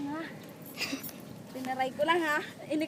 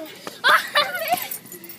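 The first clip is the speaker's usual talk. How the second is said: high burst of laughter; loud laugh; 8 semitones higher, 7 dB louder than usual. Real, not acted. Laughs at next obstacle.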